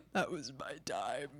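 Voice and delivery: mock old-timer. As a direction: sigh like an old veteran. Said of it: "And I'm like".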